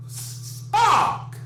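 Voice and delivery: angry outburst. Acted. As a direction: explode on the curse after a held hiss. Agent's outburst before throwing chair.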